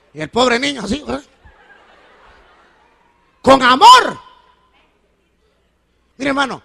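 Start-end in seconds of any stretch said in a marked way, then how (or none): 3.4-4.2 loud ironic punchline. Acted. Ironic punchline after hitting story.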